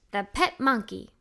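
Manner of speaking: plain reading voice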